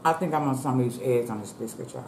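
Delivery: talking between bites; muffled by food; mouth full chewing. Real, not acted. Seen 0: Bit biscuit at -5; lips closed, chewing motion while speaking.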